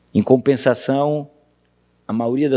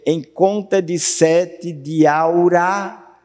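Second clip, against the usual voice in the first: slow, stretched "em conta de sete de aura"; syllable-by-syllable scansion; 4 semitones higher, much slower than usual. Shown as a demonstration. Teacher showing where vowels join, slow scansion. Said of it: "Esse aí se junta".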